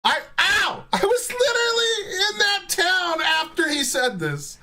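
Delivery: real excited shouting; excited outburst. Real, not acted. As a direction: yelp it out, can't believe it. Reacting to his hometown clip.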